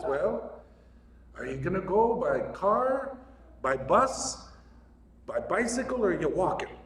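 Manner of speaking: put-on a modest stab at an Irish accent. Old Irishman Paddy answers a lost tourist in a light Irish accent. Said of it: "I don't know if my Irish accent's any good".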